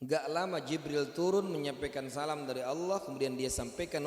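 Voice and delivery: usual speaking voice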